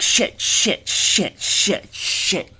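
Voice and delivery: high hissed panicked cursing; boss panicking. Acted. Boss alone with the mess, hissed panicked curses. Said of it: "the boss is like".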